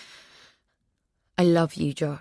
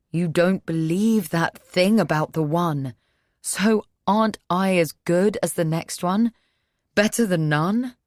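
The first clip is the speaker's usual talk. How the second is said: desperate pleading; 3 semitones higher, 4 dB louder than usual. Acted. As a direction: push the plea, rising hope. Josh begging Cass to marry.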